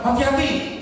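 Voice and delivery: mild imitation of an alarmed cry; retold startled cry. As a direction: imitate the wife blurting a warning. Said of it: "dia bersuara keras".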